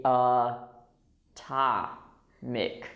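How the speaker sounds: uh, TA, mic, each syllable apart; stressed T. Shown as a demonstration. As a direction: separate "ta-mic" with a puffed T. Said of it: "when the T is stressed".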